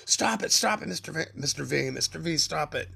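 high, mock-panicked pleading; pleading principal. Principal begging dad to stop, high mock pleading. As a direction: imitate a principal begging from the next room. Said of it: "all you heard was my principal".

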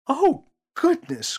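mother aghast; cried out. Acted. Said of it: "cried Mother".

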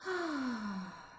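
long falling exhaled sigh; deep sigh. Shown as a demonstration. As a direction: let out a high, falling "ah" of release. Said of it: "exhale with a deep sigh".

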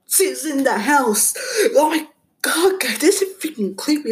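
the intruder is inside and she panics; panicked. Acted.

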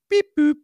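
high, loud electronic beep-boop; mimicked gadget chime; imitated device beep. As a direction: chirp two short high tones like a gadget. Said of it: "'Cause it, like, goes".

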